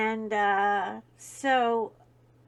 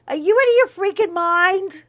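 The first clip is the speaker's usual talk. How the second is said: higher, louder indignant shout; mock outraged yell; 8 semitones higher, 11 dB louder than usual. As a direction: yell it in disbelief at your brother. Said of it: "He's like".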